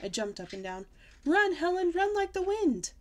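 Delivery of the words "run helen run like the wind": high, loud frantic command; panicked urging. Acted. Girl urges her grandma to run, panicking. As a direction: make her flee now.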